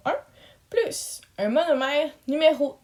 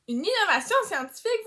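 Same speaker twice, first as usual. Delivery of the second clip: higher, fast, rising disbelief; surprised echo; 7 semitones higher, faster than usual. Acted. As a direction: repeat it as if amazed. Echo repeated as a question.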